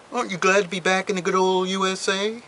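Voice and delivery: mock hearty greeting. Greeting a returning expatriate, in a mock hearty voice. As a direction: play the back-slapping welcome-home greeter. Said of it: "was the usual greeting".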